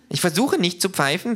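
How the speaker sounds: high, huffy retort; offended. A narrator's reading. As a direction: snap back, offended. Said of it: "sagt das Känguru eingeschnappt".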